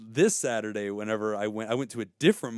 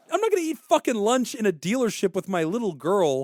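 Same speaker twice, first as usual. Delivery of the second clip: raised, louder, higher exasperated rant; retold angry outburst; 7 semitones higher, 4 dB louder than usual. Retelling blowing up at the car salesman, angry and loud. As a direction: let the frustration burst out at the salesman.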